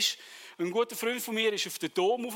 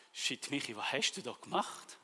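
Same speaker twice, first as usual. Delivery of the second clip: muttered self-reproach; 7 semitones lower, 8 dB quieter than usual. Retold or imitated.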